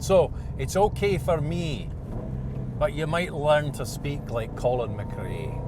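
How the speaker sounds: put-on light Scottish accent, easygoing; a touch of Scottish lilt, half joking. Vlogger claiming his Scottish parents let him do it, light Scottish accent. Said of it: "I am certified to do a Scottish accent".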